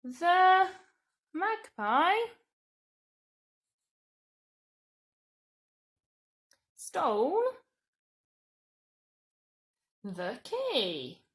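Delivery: words spaced out for writing; slow dictation. Shown as a demonstration. Teacher dictating a statement slowly. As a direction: dictate it one word at a time. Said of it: "We could say".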